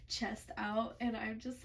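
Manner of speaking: ordinary talk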